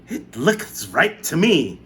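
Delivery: aunt breaking the silence; grudging aunt. A narrator's reading. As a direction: drag it out. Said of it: "Aunt Spiker said".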